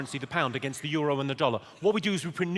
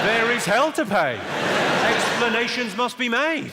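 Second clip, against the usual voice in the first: mock dramatic warning; 6 semitones higher, 5 dB louder, slower than usual. Mock outrage about explanations.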